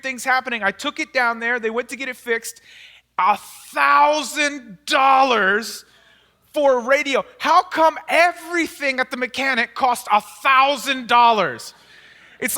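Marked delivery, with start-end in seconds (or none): 3.2-11.7 outraged exclaim. Retold or imitated. Outrage at mechanic's price.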